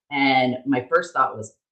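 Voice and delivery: normal talking voice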